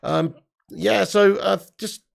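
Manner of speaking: normal talking voice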